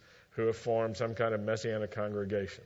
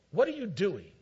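higher, confronting voice of God; 7 semitones higher than usual. Acted. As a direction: challenge him sharply, as God catching Shaul. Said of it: "comes to Shaul and says".

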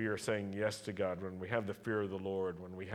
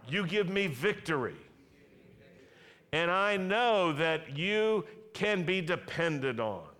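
rising fervent declaration; 6 semitones higher, 6 dB louder than usual. Shown as a demonstration. Preacher leads the congregation in declaring it, building higher and louder. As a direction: lift each line higher, declaring it to the Lord. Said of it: "You can say it with me".

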